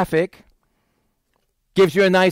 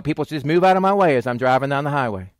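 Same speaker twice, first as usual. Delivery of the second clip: ordinary delivery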